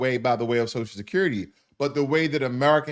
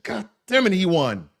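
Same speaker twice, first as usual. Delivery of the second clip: mildly exasperated mock outburst, higher at the start; exasperated inner voice; 4 semitones higher than usual. Host imagines her inner reaction to losing, mildly exasperated. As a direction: voice her private frustration, lightly put on. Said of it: "That's what she was saying inside".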